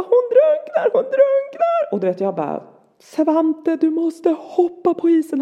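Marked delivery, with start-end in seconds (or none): 0.0-1.9 very high, drawn-out frantic cry; retold wail of panic, loud and high; panicked child's scream. Son screams for help. 3.0-5.4 high, urgent call with drawn-out words; acted frantic mother's shout; retold shouting. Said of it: "skriker jag bara så här".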